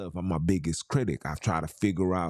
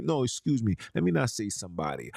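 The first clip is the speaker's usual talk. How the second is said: ordinary talk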